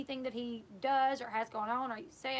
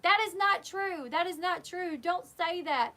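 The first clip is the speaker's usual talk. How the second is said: higher, louder insistent denial; retold loud protest; 5 semitones higher, 4 dB louder than usual. Mackenzie protesting Josh's claim, loud imitated denial. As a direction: push back hard, imitating her.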